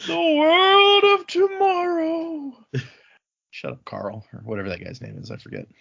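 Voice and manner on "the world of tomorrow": high, slow, grand announcer voice; mock announcer. Joking aside to friends, mock grand announcer. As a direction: intone it like an old newsreel.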